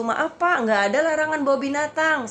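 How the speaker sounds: high, loud calling across; re-enacted yelling back. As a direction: call out a protest to someone across the street. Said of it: "saya teriak lagi ke situ".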